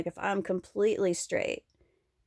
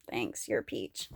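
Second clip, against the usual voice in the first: whisper; 11 semitones lower, 8 dB quieter than usual. Retold or imitated. Mock thanks to friend.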